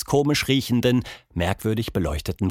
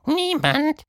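very high, dainty little voice; tiny sprite answering coyly; high, tender, rising niemand; delicate voice. Acted. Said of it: "sagte eine zarte Stimme".

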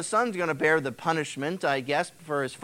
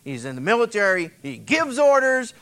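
ordinary talk

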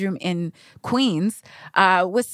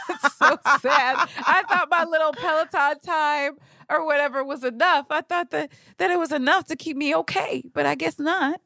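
higher, laughing mock sadness; laughing lament; 7 semitones higher than usual. Real, not acted. Writer mourns her Peloton routine, laughing mock sadness. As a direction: moan about it through a laugh.

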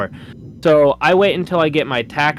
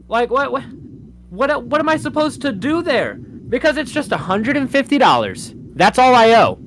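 exasperated rant; 9 semitones higher than usual. Real, not acted. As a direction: vent about an unfair bill. It still makes me very upset.

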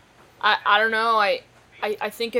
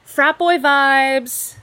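mock drawl; 4 semitones higher, 8 dB louder, much slower than usual. Mocking frat boys.

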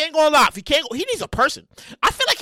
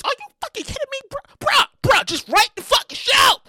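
higher, incredulous threat; high exasperated outburst; 10 semitones higher than usual. Pretend. Host self threatening writer alter ego, high. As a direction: blow up at yourself. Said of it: "I'm like".

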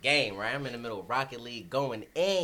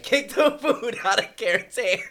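laughing hard through the story; words breaking into loud laughter; cracking up while telling it; 12 semitones higher, 13 dB louder than usual. Real, not acted.

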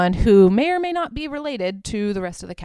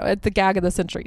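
usual speaking voice

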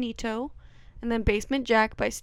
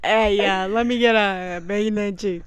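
loud put-on New York deli order; New York accent; 2 semitones lower, 4 dB louder than usual. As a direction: order like a brash New Yorker at the counter. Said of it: "a way that New Yorkers say it".

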